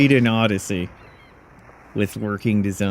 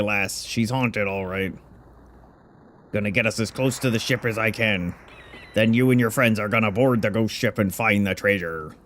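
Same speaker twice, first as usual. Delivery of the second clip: lightly put-on pirate accent; an old sea dog striking a deal; pirate captain voice. Old captain bargaining with the hero, mild pirate voice.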